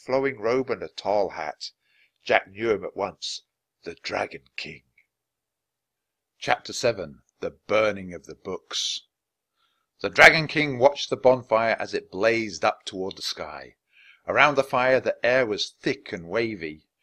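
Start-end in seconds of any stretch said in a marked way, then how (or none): none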